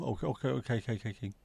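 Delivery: ordinary talk, nothing marked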